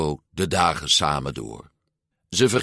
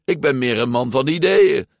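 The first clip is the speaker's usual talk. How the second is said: higher-pitched anxious protest; complaining; 6 semitones higher, 4 dB louder than usual. A narrator's reading. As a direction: whine your way out of it. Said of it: "klaagde Luca". (klagend, met hoge stem)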